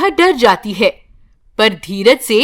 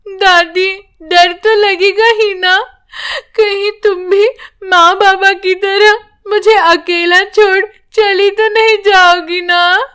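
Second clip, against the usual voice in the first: put-on higher, crying little girl pleading; tearful child; 8 semitones higher than usual. A narrator's reading. Orphaned granddaughter begs grandma not to leave her, crying. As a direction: plead through tears not to be left alone.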